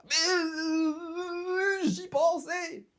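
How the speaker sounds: long, high, loud wail of words; sudden outcry. Acted. Character reacts to friends' crazy adventures, crying out high. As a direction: break in loudly, dragging it out high.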